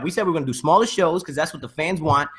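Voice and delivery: ordinary delivery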